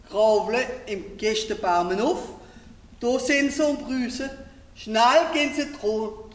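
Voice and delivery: projected, lilting dialect verse; Alsatian poem recitation. Acted. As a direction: recite the Alsatian rhyme to an audience.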